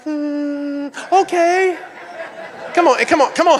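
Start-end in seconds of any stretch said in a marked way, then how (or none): 0.0-1.8 high-pitched grudging agreement; mock reluctant okay. Husband answering wife's grocery store text, mock reluctant. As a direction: hum it out high, then give in. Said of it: "and I'm like".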